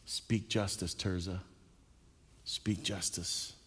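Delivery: almost a prayer, quiet and slow; hushed exhortation. Acted.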